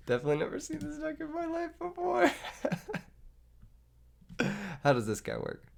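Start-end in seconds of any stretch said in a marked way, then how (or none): 0.1-2.3 a much higher, laugh-filled denial; laughing sarcasm. Real, not acted. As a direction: giggle through the fake denial.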